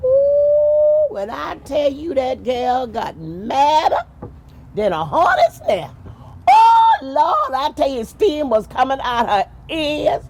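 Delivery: high, loud, slow excited exclaiming; storyteller loudly exclaiming how mad she got. A narrator's reading. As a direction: whoop it up about her anger. Teller builds up wife's anger.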